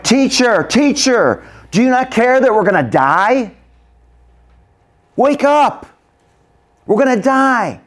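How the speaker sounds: high, loud, frantic yelling; panicked crying out. Acted. Disciples rebuking Jesus in the storm, panicked crying out. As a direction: shout to wake him, desperate. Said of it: "They got a little excited".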